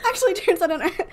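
giggling through the words; laughing. Real, not acted. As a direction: laugh as you admit it. Laughing at own slip.

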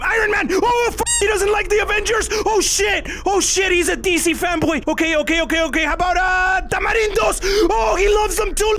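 frantic high-pitched yelling in panic; panicked shouting. Real, not acted. Panicked candy-luring gone wrong.

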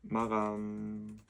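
slow stretched announcement; drawn-out call. Real, not acted. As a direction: stretch the word out slowly.

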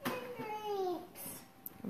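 high, slow, soft whiny sing-song; mock whine. Mother to viewers, mocking her whining child, in a high slow mock whine.

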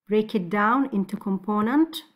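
usual speaking voice

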